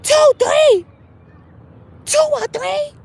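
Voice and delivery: very high, loud outraged squeal; mock-horrified church lady; shocked elder. Elder hears baby's age, high shocked squeal. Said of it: "elder shocked at child's age".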